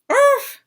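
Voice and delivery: high, loud doggy "Oof!"; yelping dog grunt of frustration; frustrated bark. Acted. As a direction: bark out your annoyance like a dog. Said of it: "he barked in frustration".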